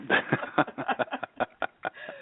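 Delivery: giggling burst; laugh. Real, not acted. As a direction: laugh at your own joke. Laughs at own joke.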